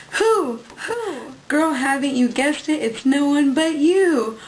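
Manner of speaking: sing-song dramatic card reading; hammed-up greeting-card reading; reading the card aloud. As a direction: read the riddle like a big reveal, building up.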